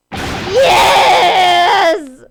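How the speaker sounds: loud laughing shout of victory; triumphant yes. Real, not acted. Player cheering a win, laughing shout.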